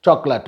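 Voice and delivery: "Chocolate" as CHOK-lut, weak last vowel; "Chocolate" with a reduced schwa ending; two-syllable chocolate. Shown as a demonstration. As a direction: end "Chocolate" on a short, unstressed "lut". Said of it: "notice the pronunciation of this word".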